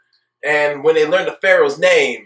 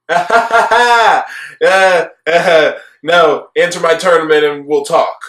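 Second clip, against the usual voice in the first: mocking Kaiba; 5 dB louder than usual. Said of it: "Kaiba basically says".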